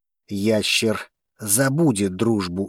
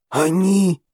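breathless; 8 semitones higher than usual. A narrator's reading. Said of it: "затаив дыхание".

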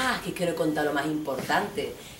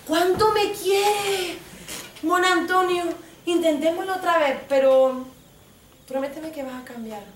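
very high, slow and sweet; coy girlfriend; 11 semitones higher, slower than usual. Retold or imitated. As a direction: coo it at him. She sweetly forgives.

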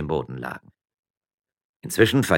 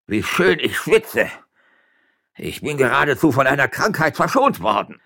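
loud, high-pitched self-satisfied exclamation; raised, animated old man's voice; calling out; 9 semitones higher, 8 dB louder than usual. A narrator's reading. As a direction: call it out in pleased relief. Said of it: "rief er".

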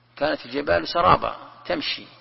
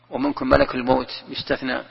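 ordinary talk, nothing marked

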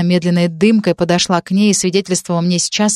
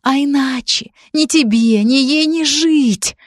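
higher, slow deadly threat; angry girl threatening Dan and the rival, slow and higher; exclaimed threat; 7 semitones higher, slower than usual. Acted. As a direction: spit out the final threat, pitched high. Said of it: "воскликнула она".

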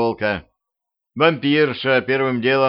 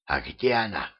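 hushed, softer question; whisper; 3 semitones lower, 7 dB quieter than usual. A narrator's reading. Petrovich asking the wizard where Matilda is, whispering. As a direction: ask it under the breath, afraid she'll hear. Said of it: "шепотом спросил".

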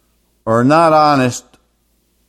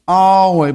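Always said higher and louder, falling; punched always; 5 semitones higher, 3 dB louder than usual. Acted. Preacher cutting off doubt with one word, emphatic.

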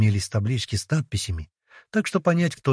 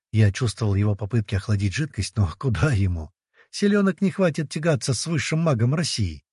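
ordinary delivery, nothing marked

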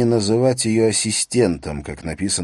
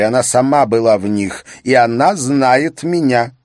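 raised, louder voice of a husband justifying himself; defensive husband; 5 semitones higher, 6 dB louder than usual. Acted. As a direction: argue your case, higher and forceful.

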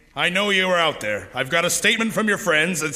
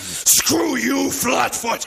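defiant shout; 6 semitones higher, much slower than usual. Acted. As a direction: yell it defiantly, higher. Defiant insult during beating.

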